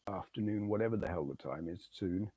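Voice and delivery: normal talking voice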